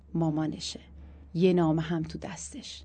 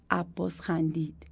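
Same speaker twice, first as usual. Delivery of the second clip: plain reading voice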